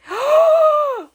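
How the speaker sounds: huge, high, loud gasp; big astonished intake of breath; shocked gasp. Real, not acted. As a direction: gasp loudly in amazement. Shocked by the gift.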